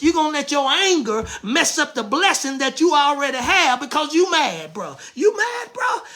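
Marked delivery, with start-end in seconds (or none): 5.1-6.0 mocking taunt. The preacher taunting a hothead in the pews, mocking and high. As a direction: needle him, pitch jumping way up.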